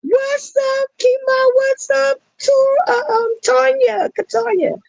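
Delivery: very high, singsong welcome; excited greeting. Real, not acted. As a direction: squeal each name with joy. Greeting viewers by name on stream.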